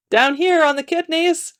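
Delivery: high-pitched character voice; new voice. A narrator's reading. New trader answering a call for kidneys, high voice. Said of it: "came a new voice".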